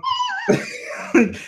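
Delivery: high shrieking laugh burst; high squeal of laughter. Real, not acted. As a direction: let out a sudden squealing laugh. Burst of laughter mid rant.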